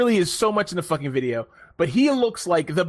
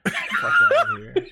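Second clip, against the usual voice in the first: much higher, laughing brush-off; laughing dismissal. Real, not acted. Laughing off a friend's joke, high dismissal. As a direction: toss it off while cracking up.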